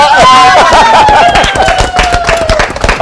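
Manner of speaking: sudden high delighted shriek of laughter; real burst of laughter. Real, not acted. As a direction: let out a squealing laugh. Winner revealed on live TV.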